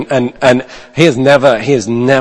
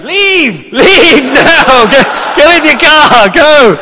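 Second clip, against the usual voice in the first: much higher, louder retold shouting, half laughing; shouting urgently; 4 dB louder, much slower than usual. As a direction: yell at him to get out, fast. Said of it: "So I'm shouting".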